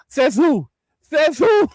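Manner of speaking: defiant shout. Retold or imitated. Sergeant's defiant retort.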